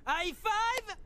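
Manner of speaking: high, loud rallying shout; team cheer. Acted. Team cheer after plan. (cri d'équipe enthousiaste)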